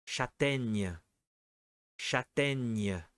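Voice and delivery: Châtaigne said again, careful and clear; repeated pronunciation. Shown as a demonstration. Teacher repeating the hard word Châtaigne. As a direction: say Châtaigne again for the class to copy. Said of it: "Difficile la dernière, répète".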